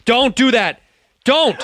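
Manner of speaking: high, loud scolding; loud protest. Real, not acted. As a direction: shut the bit down loudly. Loud protest at the accent bit.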